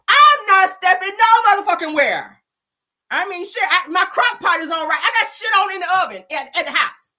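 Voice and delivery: loud, high and indignant to the cop; hammed-up entitled driver in a hurry; entitled back-talk.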